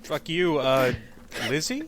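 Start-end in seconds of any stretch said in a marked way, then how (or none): 1.5-1.8 giggling through the words; laughing. Real, not acted. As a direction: laugh while answering. Laughs when named by teammate.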